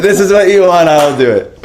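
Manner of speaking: high and laughing, then settling. Retold or imitated. Repeating to Krishna, laughing while dancing. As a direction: laugh out the line as you give in.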